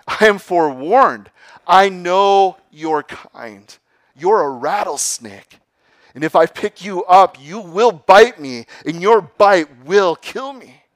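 alarmed youth. Acted. Youth warning the rattlesnake away, loud and high. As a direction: warn it off, voice up and loud.

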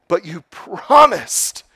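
high, loud, slow cry of betrayal; crying out betrayed. Acted. Bitten youth crying out at the snake, high and loud. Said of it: "cried the youth".

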